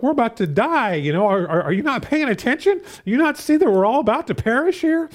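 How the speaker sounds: high, frantic cry of alarm; panicked disciples. Retold or imitated. Preacher voicing terrified disciples in the storm, high panicked pleading. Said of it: "they're all panicked".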